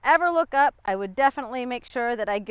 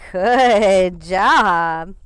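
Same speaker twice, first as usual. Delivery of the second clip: loud, long-drawn approval; hearty dog praise; 3 semitones lower, 9 dB louder than usual. Real, not acted. Praising the dog while training.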